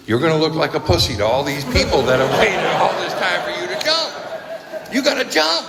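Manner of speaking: building to a high shout; urging inner voice. Retold or imitated. His head telling him to jump off the bridge, insistent shouting.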